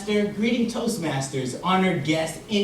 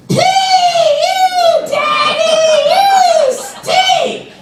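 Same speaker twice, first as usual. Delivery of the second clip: put-on high, loud little-girl voice, dragged out slowly; loud, high child blurting out an embarrassing question; little daughter shouting; 13 dB louder, much slower than usual. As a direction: shout it across the store like a delighted toddler. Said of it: "and then she says".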